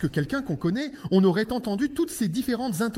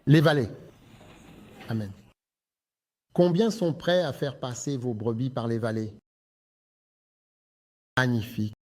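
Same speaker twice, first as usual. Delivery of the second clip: lower, measured sermon voice; preaching; 10 semitones lower than usual. Acted. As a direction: preach with weight.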